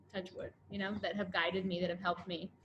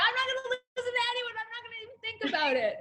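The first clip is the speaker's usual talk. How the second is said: stubborn defiance; 12 semitones higher, faster than usual. Retold or imitated. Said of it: "and I was like".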